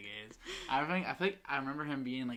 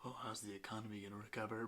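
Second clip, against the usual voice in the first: whispered, fretting like a tiny worrier; mock worried whisper; 3 semitones lower, 11 dB quieter than usual. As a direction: breathe it out as an anxious puppy. Puppies imagined worrying.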